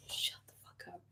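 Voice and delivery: unvoiced whisper, mock exasperation; whispered retort. Reacting to friends fancying her brother, whispered retort. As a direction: whisper it as an aside. Muttered reply to friends' flirting.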